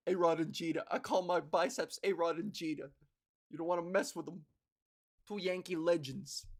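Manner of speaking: cocky New York Yankees fan; New York accent. Acted. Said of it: "I think that's a New York accent".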